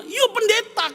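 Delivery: put-on high, loud shout of a scolding child; child's shout. Eldest child yells at arguing father, loud high shout.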